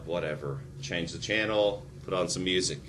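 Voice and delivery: bored, dropped-off mock aside. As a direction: shrug it off, quiet and lazy. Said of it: "I'm like".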